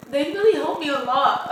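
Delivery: teary, holding back tears; shaky sigh. Real, not acted. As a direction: voice wobbling, near crying. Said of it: "I'm gonna start crying, I can feel it".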